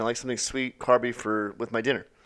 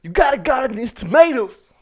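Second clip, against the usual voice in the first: earnest movie teacher, higher and louder; 11 semitones higher, 6 dB louder than usual. Pretend. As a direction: play the inspiring teacher in a film. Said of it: "Someone will play, you know, Michelle Pfeiffer".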